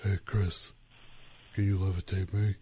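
soft, lightly voiced celebrity cameo; Shaquille O'Neal. Basketball star asking magician to levitate him, lightly imitated. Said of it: "where he goes".

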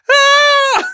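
shrill high startled scream; loud fake scream of fright; mock scream. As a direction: scream like someone jumped by a prankster. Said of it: "get someone being like".

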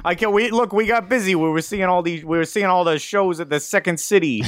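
put-on hammy Chicago worker's excuse, Chicago accent. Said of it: "my ability to do a Chicago accent".

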